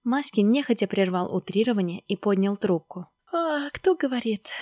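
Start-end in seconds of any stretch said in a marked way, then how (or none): 3.3-4.5 slow, high yawned question; yawning. Acted. Maskin answers the early phone call, yawning. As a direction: ask it through a sleepy yawn. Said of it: "зевая, спросил Маськин".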